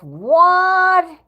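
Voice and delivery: high, loud, drawn-out "what?"; mock baffled. Host imitating her with head cocked, mock baffled. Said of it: "puts her hands up as if".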